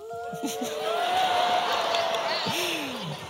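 very high, drawn-out whoop; long wordless "woo" of celebration; army cheer. Acted. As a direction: cheer like a soldier in a crowd. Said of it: "all of the army cheered".

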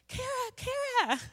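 very high, drawn-out squeal of a name; excited calling out. Retold or imitated. Decor helper calling from the kitchen, excited squeal. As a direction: imitate a friend squealing for attention. Friend calls her over excitedly.

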